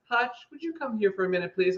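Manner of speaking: imitating a Dolly voice. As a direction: mimic her husband's Dolly voice. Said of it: "It was [name] coming out of your mouth".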